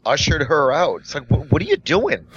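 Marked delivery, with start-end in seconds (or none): 1.3-2.2 high-pitched stammered disbelief; mock-aghast question, pitched well up; retold incredulous challenge. Barista ushers the woman out, incredulous.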